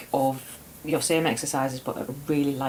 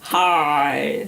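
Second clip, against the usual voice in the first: laughing, exaggerated hi; self-mocking weirdo greeting; 4 dB louder than usual. Shown as a demonstration. Co-host mocking her own greeting, hammed-up weirdo. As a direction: send up your own odd hello.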